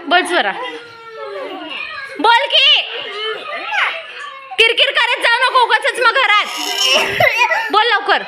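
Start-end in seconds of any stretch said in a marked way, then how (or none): 2.2-8.2 high-pitched, impatient telling-off; real parental exasperation; exasperated scolding. Real, not acted. Parent scolds whining child.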